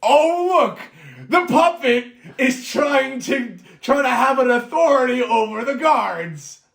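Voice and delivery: high, loud mocking sneer, slowing at the end; dwarf mocking Yarner before the guards, loud and high. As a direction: cackle down at the puppet. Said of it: "he says in reply".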